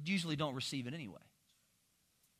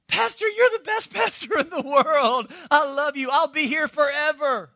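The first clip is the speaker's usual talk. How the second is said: high, slow, mocking fawn; mocking sappy usher; 8 semitones higher, 6 dB louder than usual. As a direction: sneer it back in a sugary voice. Mocks the usher's empty vow.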